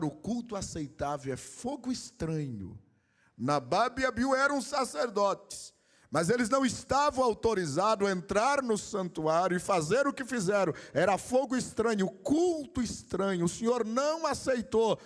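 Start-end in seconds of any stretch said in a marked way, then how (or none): 3.4-15.0 high, forceful, insistent preaching; fervent preaching. Real, not acted. Pastor warning the church about Nadab and Abiú's strange fire, fervent preaching. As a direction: drive each phrase home at a raised pitch.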